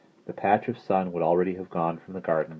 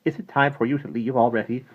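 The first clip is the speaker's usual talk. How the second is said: mildly surprised, higher-pitched question; exclaimed; 7 semitones higher, 5 dB louder than usual. A narrator's reading. Miss Christabel learns Maggie is leaving, surprised question. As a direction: ask with raised, surprised voice. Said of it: "Miss Christabel exclaimed".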